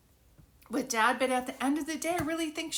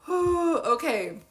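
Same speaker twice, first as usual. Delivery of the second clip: emotional gasp; 5 semitones higher, 6 dB louder, slower than usual. Real, not acted.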